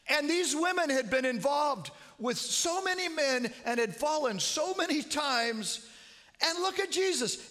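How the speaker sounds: loud, high, slowing preach. Retold or imitated. Preaching to dying mother-in-law.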